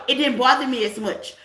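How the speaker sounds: ordinary delivery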